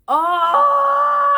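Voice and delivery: shouted high oh of remembering; sudden realization. Real, not acted. Remembering the fight, a loud sudden oh. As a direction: it just came back to her, cry it out. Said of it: "I know".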